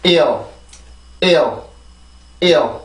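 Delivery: 이어 as one falling glide, repeated with pauses; 이어 said as a single English-style syllable; pronunciation drill. Shown as a demonstration. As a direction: model 이어 for learners, isolated and falling. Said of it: "다시 한번 해볼게요".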